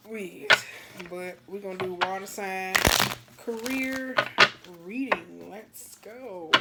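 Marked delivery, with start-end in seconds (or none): 5.5-6.5 hushed breathy let's go; whispered. Real, not acted. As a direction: breathe it out softly. Repeated hype call, soft then loud.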